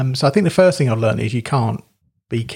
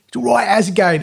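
high-pitched mock-Cockney voice; jokey Cockney impression, pitched up; Danny Dyer; 8 semitones higher, 3 dB louder than usual. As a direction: put on a cheeky London geezer accent. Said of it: "he just sounds like, like Danny Dyer".

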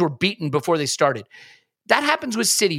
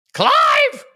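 high shouted name; high mock shout to get Clive's attention; calling out; 6 dB louder than usual. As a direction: yell his co-host's name in a high put-on voice. Calls out co-host in character.

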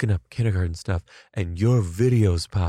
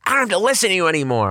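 high, fast burst; rapid high-pitched mock outburst; acted outburst; 10 semitones higher, much faster than usual. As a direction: blurt it out high and rushed, imitating. Quoting a gleeful outburst in story.

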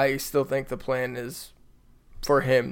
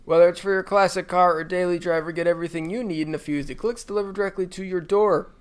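ad read; 4 semitones higher than usual. Acted. Reads sponsor ad.

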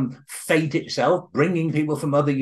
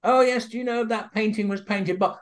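put-on old man; 8 semitones higher than usual. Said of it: "this little old man's there again".

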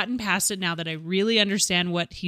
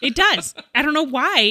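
emphatic insistence; 7 semitones higher, 6 dB louder than usual. Real, not acted. Insisting on her annoyance.

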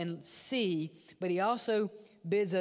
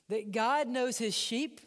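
usual speaking voice

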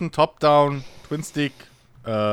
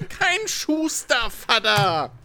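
put-on whiny child voice; 10 semitones higher, much slower than usual. Child correcting misheard father.

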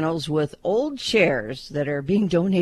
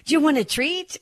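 high, rising offer to a pet; excited dog talk; 10 semitones higher than usual. Shown as a demonstration. Host offering pet dog a treat, excited dog talk. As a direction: perk up the dog with a high-pitched question. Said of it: "I could not be as excited telling".

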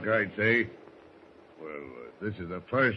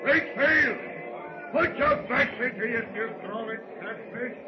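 shouted commands; 13 semitones higher than usual. Acted. First mate hollering orders at the sailors, full bellow. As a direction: yell each order across the deck.

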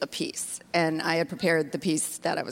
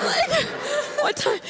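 high incredulous. Retold or imitated. Mock excitement after story.

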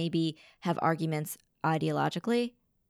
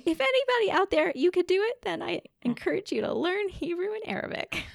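high playful voice; 14 semitones higher than usual. Real, not acted. Playful appeal to the listeners.

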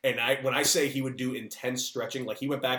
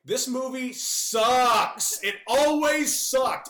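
much higher, louder and slow, rising to a shout; slow building rant; 13 semitones higher, 6 dB louder, much slower than usual. Real, not acted. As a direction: let the disgust boil over word by word. Ranting about Field of Dreams.

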